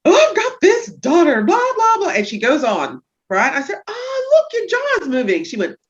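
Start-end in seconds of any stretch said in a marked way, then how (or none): 0.0-2.1 high, loud airy mimic of a patient brushing it off; mimicking patient. Chiropractor imitates her patient's chatter, high and breezy. 3.9-5.4 high, bright exclaim of noticing; mock surprise. Therapist tells patient her jaw is moving, mock-surprised and high.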